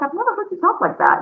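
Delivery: high, amazed disbelief; surprised. Retold or imitated. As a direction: act floored by her new voice. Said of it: "And I was like".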